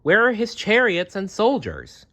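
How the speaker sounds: put-on high, searching child's voice; boy expecting chariots; puzzled boy. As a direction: ask it baffled. Jerem searching for the king.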